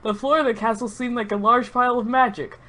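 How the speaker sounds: normal talking voice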